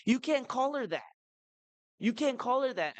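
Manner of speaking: put-on high-pitched indignant child's protest; child's protest, voice pitched well up; upset young daughter. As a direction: imitate an upset little girl objecting. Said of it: "My older daughter got so upset".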